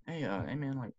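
a hint of a laid-back coach voice; mock coach voice. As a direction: play the coach, light and high, a bit hushed. Coach asks about missing pads.

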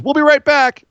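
high, hammed-up host throwing to a break; mock chirpy TV sign-off; Shane McMahon. Host mimicking the throw to camera mid-brawl, mock announcer.

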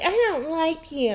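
put-on high, slow childish taunt; childish mock. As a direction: sneer it like a kid.

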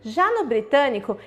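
ordinary delivery

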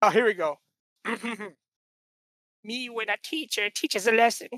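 ordinary delivery

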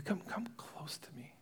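soft repeated whispered beckoning. Acted. Joseph coaxing his brothers closer, whispering. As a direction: coax them closer, barely voiced.

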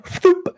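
high popping thump; grenade thump sound. Retold or imitated. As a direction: imitate the grenade launcher firing. Imitating grenade launcher.

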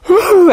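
high wailing "whew"; imitated crying wail. Lady breaks down over her sinful heart, imitated wail. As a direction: let out a brief mock sob, pitched high.

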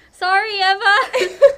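loud, high, drawn-out apology through laughter; laughing apology. Real, not acted. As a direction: call out sorry while cracking up. Apologizing to someone for the outtake.

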